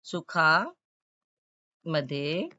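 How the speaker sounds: सुखामध्ये drawn out very slowly, syllable by syllable; slow spelling-out. Shown as a demonstration. Teacher dictating a word to students, slowly. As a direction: stretch सुखामध्ये so the children can spell it. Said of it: "आपको लिखा हुआ है".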